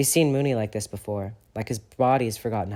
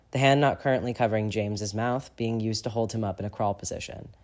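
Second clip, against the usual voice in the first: ordinary delivery, nothing marked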